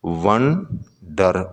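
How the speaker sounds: Wonder drawn out slowly, syllable by syllable; slow syllable demo. Shown as a demonstration. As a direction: stretch wonder so both syllables show. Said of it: "wonder में दो syllable". ("Wonder" धीरे-धीरे, हर syllable अलग)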